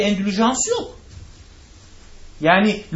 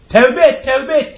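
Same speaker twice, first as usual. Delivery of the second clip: retold shout; 5 semitones higher, 6 dB louder, slower than usual. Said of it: "diye bağırdı".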